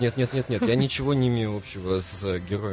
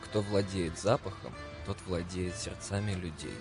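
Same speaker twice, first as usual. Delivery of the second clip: dramatic literary reading; 6 dB quieter than usual. Acted. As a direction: read it like a radio poem. Reads Süskind quote over music.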